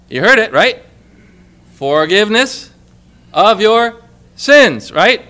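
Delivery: preacher pressing the congregation, building loud; emphatic. Real, not acted. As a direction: drive home forgiveness of your sins. Preacher hammering the point.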